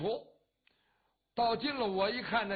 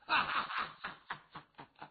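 a burst of mocking laughter from the crowd; crowd laughing; 2 semitones lower than usual. A narrator's reading. Disciples laughing at the half-naked rider, mocking laugh. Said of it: "大伙儿都乐了".

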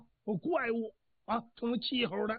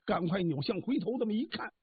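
usual speaking voice

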